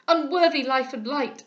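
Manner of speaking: loud despairing lament; father thrown on the ground, loud; anguished cry. A narrator's reading. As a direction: rail against himself. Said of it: "cried he".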